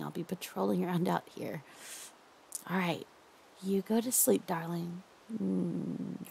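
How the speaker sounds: hushed, doting captor who won't let go. Acted. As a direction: lull your captive with creepy tenderness. Holds a soft captor roleplay voice.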